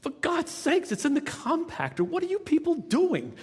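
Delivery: high, loud cry of disbelief; exasperated, appalled shout; aghast outburst. Retold or imitated. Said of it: "and I gasped".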